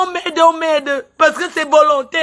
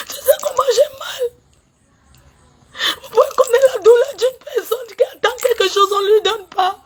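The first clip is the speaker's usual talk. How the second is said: high, tearful wail breaking into sobs; pleading through tears for her money; crying out her pain, voice cracking; real crying; 6 semitones higher than usual. Real, not acted. Breaks down over unpaid money.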